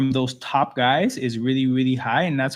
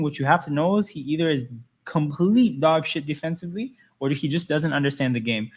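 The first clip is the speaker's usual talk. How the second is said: ordinary talk, nothing marked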